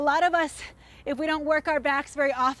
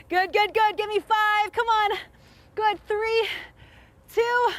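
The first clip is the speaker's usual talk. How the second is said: higher, louder coach countdown; hyped coaching; 7 semitones higher, 4 dB louder than usual. Real, not acted. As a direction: pump up the class to finish. Coach hyping final reps.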